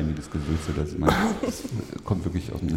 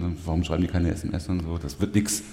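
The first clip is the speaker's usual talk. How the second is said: normal talking voice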